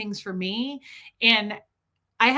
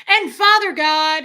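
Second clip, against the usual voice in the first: loud prayer, pitched higher; Fred; 5 semitones higher, 9 dB louder than usual. Retold or imitated. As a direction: imitate a hard-of-hearing man praying loudly. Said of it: "he was so loud because he couldn't hear".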